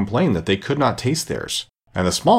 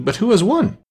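eager question; 11 semitones higher than usual. A narrator's reading. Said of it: "panting and asking".

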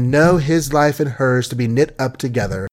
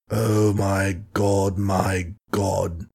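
slow, low, softer anguished groan; groaning despair; 4 semitones lower, 4 dB quieter, much slower than usual. Acted. Old colonel alone after his daughter leaves, groaning. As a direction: let it sink out of him. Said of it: "groaned the poor colonel".

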